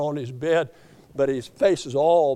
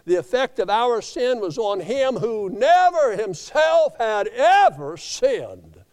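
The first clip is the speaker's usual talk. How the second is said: preaching climax; 5 semitones higher, 5 dB louder, slower than usual. Acted. Preacher climax, then slowing.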